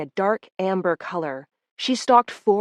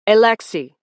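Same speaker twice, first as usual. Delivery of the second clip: sharp, loud incantation; shouted spell; 2 semitones higher, 6 dB louder than usual. Acted. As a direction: call out the spell word. Casting a memory charm spell.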